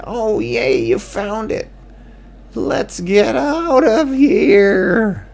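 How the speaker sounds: high, happy little bat; high excited bat voice. Acted. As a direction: squeal it with joy.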